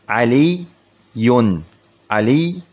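علي split as ʕaliy, pause, yun; doubled ي. Shown as a demonstration. Teacher showing the two ya's, علي said as ʕaliy-yun in parts. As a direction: break علي apart so both ي sounds are heard. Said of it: "هذا فيه ياءان".

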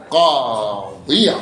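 "قاضيا" بمدّ وتنوين، ببطء شديد; اضغط على "قاضيا" ببطء وبنغمة أخفض; مثال منطوق. Shown as a demonstration. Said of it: "شو تقول". (نطق مثال النصب ببطء)

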